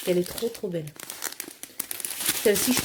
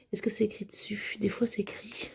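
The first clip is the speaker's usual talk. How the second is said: soft whisper while checking the stylets; whisper to herself; 8 dB quieter than usual. Real, not acted. Checking the stylet numbers, whispering to herself. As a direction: murmur it under your breath, reading the label.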